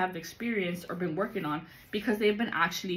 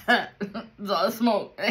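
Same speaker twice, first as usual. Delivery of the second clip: hoarse after coughing; 5 dB louder, much slower than usual. Real, not acted. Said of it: "out of breath".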